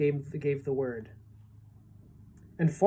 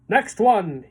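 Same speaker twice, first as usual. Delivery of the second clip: loud call; shouted; 4 semitones higher, 7 dB louder than usual. A narrator's reading. James calling for the next seagull, loud. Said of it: "he shouted".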